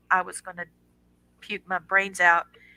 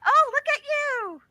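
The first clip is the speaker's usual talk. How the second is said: much higher, slow, gushing over her son; delighted mom. Real, not acted. Greeting son in graduation gown.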